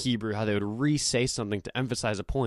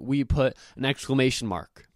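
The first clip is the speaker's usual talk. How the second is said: ordinary delivery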